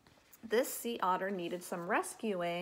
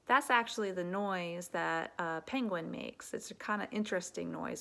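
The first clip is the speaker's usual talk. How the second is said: plain reading voice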